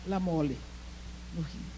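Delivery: ordinary talk, nothing marked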